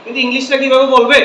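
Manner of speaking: ordinary delivery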